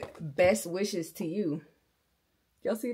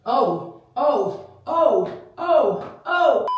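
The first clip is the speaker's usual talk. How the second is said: loud, hyped-up hollering; yelling; 3 semitones higher, 12 dB louder, slower than usual. Real, not acted. Performer hyping up a bestie, yelling loudly. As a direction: belt it out at full volume. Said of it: "I'm probably yelling".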